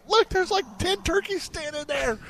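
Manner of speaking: high, eager pointing it out; excited whisper-pointing. Retold or imitated. Telling his kids about the turkeys, high and excited. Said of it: "I go".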